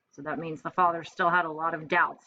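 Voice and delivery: usual speaking voice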